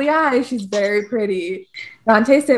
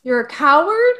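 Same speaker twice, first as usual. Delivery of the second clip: louder, higher mock disbelief; incredulous Virgil; 4 semitones higher, 6 dB louder than usual. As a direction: scold with a rising, disbelieving question. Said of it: "Virgil comes up to Dante and is like".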